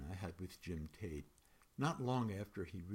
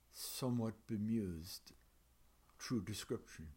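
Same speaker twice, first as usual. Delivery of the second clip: ordinary delivery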